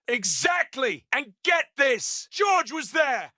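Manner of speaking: high, slow excited shout. Acted. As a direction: drive it home, thrilled. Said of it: "Kramer, nodding".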